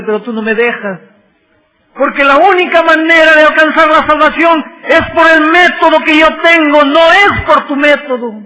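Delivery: loud, high, crying reproach; anguished divine plea. Acted. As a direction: plead with a child who won't listen. Christ pleading with stubborn Jacob.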